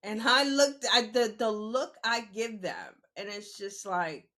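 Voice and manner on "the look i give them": usual speaking voice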